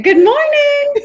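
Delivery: high, loud sing-song greeting; cheery good morning. Retold or imitated. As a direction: chirp it bright and high. Imitating her early morning call.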